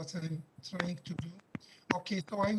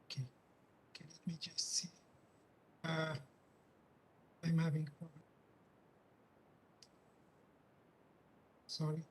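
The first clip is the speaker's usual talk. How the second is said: barely audible; 4 dB quieter than usual. Real, not acted. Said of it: "Your voice is very low".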